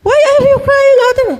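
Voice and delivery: high, loud mock scolding; exasperated rebuke. Acts God's rebuke again. (malakas na kunwaring paninita)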